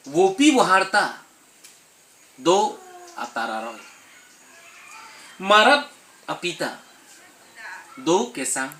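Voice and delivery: loud, high-pitched verse declamation; ringing recital, line by line with pauses; poem recitation. Acted. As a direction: declaim each line forcefully, falling away at the end.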